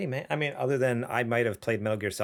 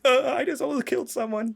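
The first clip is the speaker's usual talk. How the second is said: higher, rattled retelling of his panic; shaken up; 12 semitones higher than usual. Retold or imitated. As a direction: mildly imitate being shaken. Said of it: "I was so shook up".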